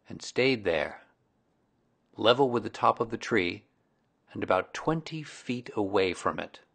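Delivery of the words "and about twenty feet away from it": ordinary delivery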